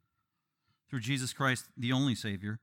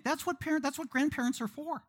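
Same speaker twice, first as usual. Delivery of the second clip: high-pitched pushy grandparent; meddling grandparent; 13 semitones higher than usual. Acted. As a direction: pipe up high, as a meddling in-law. Voices meddling in-laws.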